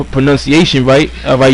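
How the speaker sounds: normal talking voice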